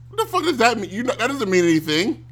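high-pitched indignant outburst; outraged disbelief. Real, not acted. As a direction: react in disbelief to the commentator. Outraged reply to commentator.

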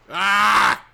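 loud, high cry of frustration; frustrated shout. Real, not acted. Venting about his health, a loud frustrated shout. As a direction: let out a sudden exasperated yell.

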